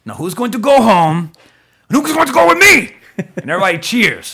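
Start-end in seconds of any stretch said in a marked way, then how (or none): 0.0-1.3 rousing speech. Retold or imitated. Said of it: "he's like". 1.9-3.0 high, loud rallying cry; rally the troops like a hammy action hero; rousing movie speech. Retold or imitated. Podcaster reenacting Van Damme rallying troops, loud and high. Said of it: "he's like".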